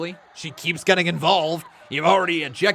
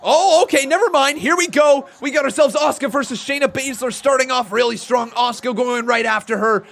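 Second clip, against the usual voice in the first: excited, louder, higher ringside calls; live play-by-play; 9 semitones higher, 9 dB louder than usual. Acted. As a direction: call the match as it happens.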